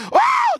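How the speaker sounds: sudden loud, very high "oh" scream; hammed-up mourner's shriek; mock funeral holler. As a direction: wail out one piercing cry. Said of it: "just say".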